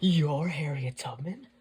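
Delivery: loud, drawn-out incredulous question rising at the end; childlike amazement. A narrator's reading. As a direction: ask it like a starstruck child. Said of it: "he said like a child".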